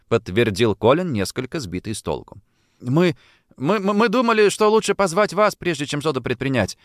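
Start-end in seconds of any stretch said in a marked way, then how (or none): 2.8-6.8 higher-pitched, stammering start; hasty interjection. A narrator's reading. Boy hastily explaining to the detective, hurried and higher. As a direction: cut in hurriedly, tripping on the first word. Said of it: "поспешно вмешался Майкл".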